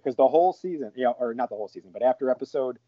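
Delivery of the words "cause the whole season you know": normal talking voice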